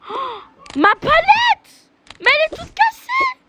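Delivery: high, slow wail over a broken palette; dismayed outcry. Acted. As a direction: shriek in outrage at the damage.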